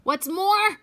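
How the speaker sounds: irritated Lorax starting another rant; snapped. Acted. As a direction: snap it out, dander up. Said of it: "snapped the Lorax".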